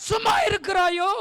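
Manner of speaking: high, full-force preacher's shout; shouted rebuke. Real, not acted. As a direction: thunder the accusing question at the church. Rebukes the complacent church. (கண்டித்து உரக்க முழங்கி)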